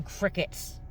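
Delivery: breathy whispered aside; sarcastic whisper about the silence; whispered crickets. Acted. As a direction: drop to a dry whisper. Sarcastic punchline, dropped voice.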